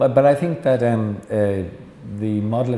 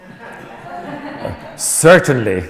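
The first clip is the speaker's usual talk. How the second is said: startled receptionist; 5 semitones higher, 7 dB louder, much slower than usual. Retold or imitated. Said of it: "receptionist answering the Pope".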